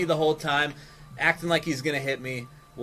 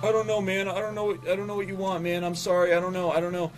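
imitating a tearful, flustered employee; mock crying; 3 semitones higher than usual. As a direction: play a crumbling employee, lightly. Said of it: "he just kinda starts to cry".

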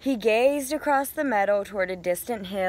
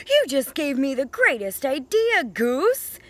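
higher, louder, bursting with a new idea; excited Duck; 5 semitones higher, 4 dB louder than usual. A narrator's reading. Duck tells Goose his big idea, eagerly. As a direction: Duck can't wait to share his plan. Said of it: "he quacked".

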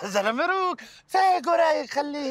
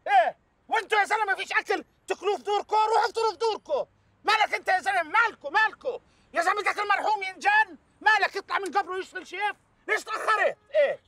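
pitched way up, exasperated yelling; angry rant; 6 semitones higher than usual. Acted. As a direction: rant at him, near shouting and fed up. Boss rants about the food.

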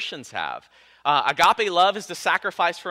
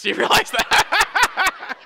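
slow, higher, laughing through the words; 6 dB louder, much slower than usual. Real, not acted. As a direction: laugh at yourself for slipping up. Laughing at own slip-up.

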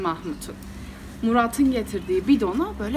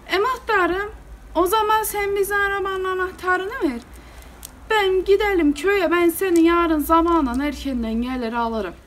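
high-pitched wheedling villager; Cinali making a proposal; 7 semitones higher than usual. Retold or imitated. Villager asking the muhtar for car keys, coaxing. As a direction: imitate a villager pitching his plan, voice raised high.

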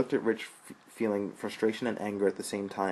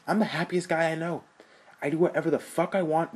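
defiant rant; 5 semitones higher, 4 dB louder than usual. Acted.

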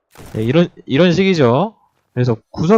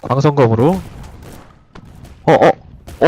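usual speaking voice

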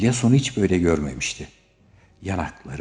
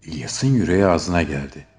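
ordinary delivery

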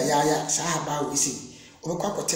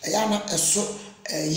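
normal talking voice

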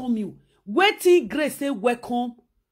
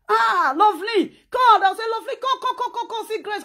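gushing welcome; 8 semitones higher, faster than usual. Retold or imitated. Hosts calling Grace over.

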